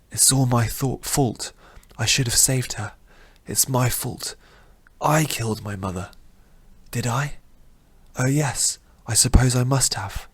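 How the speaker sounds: hushed self-accusing youth; guilt-stricken young man. Acted. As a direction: confess it quietly, sinking into guilt. Rin's guilty inner voice.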